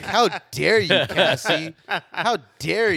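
loud, high mock indignation; hammed-up how dare you; playfully scandalized shout; mock outrage. Mock outrage at friend's request.

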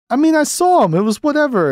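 squeaky, jittery witness voice; mock-panicked high witness; high nervous voice. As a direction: voice jumps way up, nervous and evasive. Guy from the couple, acted quote.

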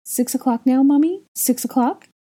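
put-on an impatient toddler pestering his mother; child Aurelian. A narrator's reading. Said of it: "Aurelian asked".

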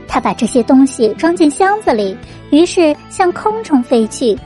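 ordinary delivery, nothing marked

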